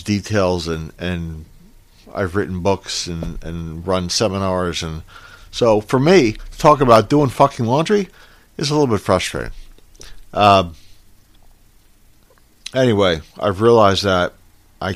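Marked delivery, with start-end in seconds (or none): 5.5-9.5 exasperated. Real, not acted. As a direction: voice rising in frustration. Frustrated at doing laundry.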